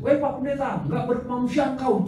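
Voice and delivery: high, loud outraged protest; woman shouting. Retold or imitated. Church woman shouting at the preacher, outraged. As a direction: cry out at the preacher in pity. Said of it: "teriak ibu-ibu".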